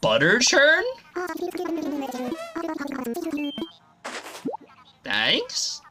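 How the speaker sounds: surprised. Real, not acted. Surprised by game gift.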